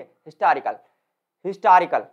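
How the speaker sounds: Historical with हिस्टोरि loud, कल weak; stress before the suffix. Shown as a demonstration. Teacher showing how to say it, हिस्टोरि stressed in historical. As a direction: push हिस्टोरि, drop off on कल. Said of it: "history पर ज्यादा sound".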